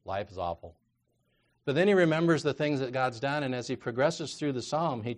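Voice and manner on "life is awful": low, quiet mock gloom; glum, subdued voicing of despair; bummed and depressed. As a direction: sigh it out, acting dejected. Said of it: "He starts the mood and he's".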